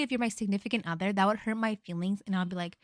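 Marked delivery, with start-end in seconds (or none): none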